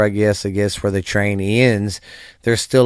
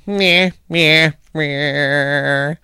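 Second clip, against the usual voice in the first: mocking blah blah; 4 semitones higher, much slower than usual. Mocking noise after self-praise.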